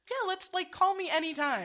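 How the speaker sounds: high, loud mock-eager offer; over-friendly invitation. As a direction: gush like an overeager friend. Voicing an over-friendly person.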